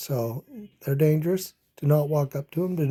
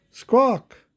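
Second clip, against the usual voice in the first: high, loud bird squawk; a sharp crow's cry, much higher and louder; 7 semitones higher, 6 dB louder than usual. Acted. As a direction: squawk it out like a crow. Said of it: "said the crow".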